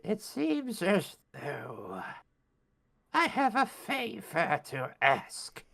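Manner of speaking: high, slow, raspy hag; old crone voice. Acted. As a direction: creak it out like an ancient witch. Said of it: "says the old crone".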